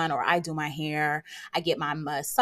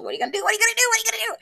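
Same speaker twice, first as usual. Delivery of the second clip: higher and louder, rising to a squeal; frantic self-talk; 4 dB louder, much faster than usual. Retold or imitated. Asking herself in a high excited panic what to do. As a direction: spiral into excited panic over free time.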